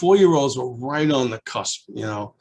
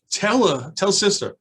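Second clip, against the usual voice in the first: eager urging; 8 semitones higher, 4 dB louder than usual. Retold or imitated. Urging the child.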